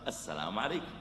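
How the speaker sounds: quiet, low gentle greeting; soft greeting. Shown as a demonstration. Preacher repeating a calm greeting, quiet and gentle. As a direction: greet him softly with a small rise. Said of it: "يا أخي، قل".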